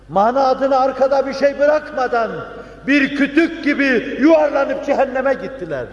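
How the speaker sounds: high, forceful denunciation; fervent preaching. Real, not acted. (coşkulu, yüksek perdeden vaaz)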